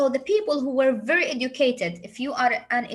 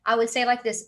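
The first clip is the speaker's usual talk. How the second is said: ordinary delivery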